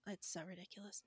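whispered aside. Real, not acted. Overwhelmed aside.

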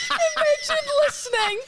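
high laughing outburst; laughing shout. Real, not acted. Laughing at the idea.

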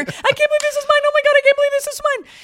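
very high, loud squealing joy; screaming in disbelief. Retold or imitated. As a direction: squeal it like an overjoyed teen.

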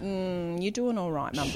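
soft, patronising murmur through a tight smile; patronising sympathy. Retold or imitated. Nine-year-old consoling Mum, softly condescending. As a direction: soothe her with quiet, fake encouragement. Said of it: "the tilted head and, like, the lipless smile".

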